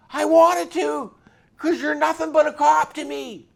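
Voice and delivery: high, loud biker's outburst; loud and high, shouting the grudge; retold biker's outburst. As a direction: belt it out like a furious biker. Biker admits wanting to attack.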